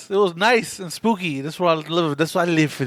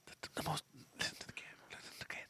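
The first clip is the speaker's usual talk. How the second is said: hushed ASMR whisper; 3 semitones lower, 23 dB quieter than usual. Pretend. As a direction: whisper it close to the mic. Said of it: "A S M R".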